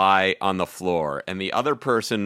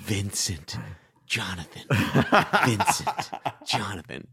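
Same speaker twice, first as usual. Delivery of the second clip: soft names, spaced by pauses; hushed reenactment; 2 semitones higher, 4 dB quieter than usual. Retold or imitated. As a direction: softly trade names, face to face. Reenacts intimate name-repeating exercise.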